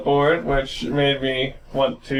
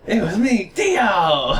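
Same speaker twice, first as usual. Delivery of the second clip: hammed-up villain line, pitched up; lightly acted anime villain reveal; Dio quote; 5 semitones higher, slower than usual. Quoting the main bad guy to friends, hammed-up.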